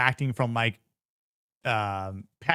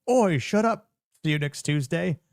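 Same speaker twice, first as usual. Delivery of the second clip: higher and louder cheeky "Oi, shut up"; mock cheeky Brit; 6 semitones higher, 4 dB louder than usual. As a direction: snap it like a cocky Londoner. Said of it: "Then David goes".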